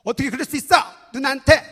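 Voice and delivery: mock big-sister telling-off, punchy bursts; imitated child scolding. Nine-year-old daughter telling off her younger brother, imitated scolding. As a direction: act out an indignant older sister. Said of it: "동생을 야단치는 거예요".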